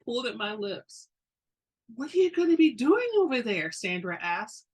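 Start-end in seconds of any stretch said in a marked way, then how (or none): 1.9-3.7 Sandra's voice. A narrator's reading.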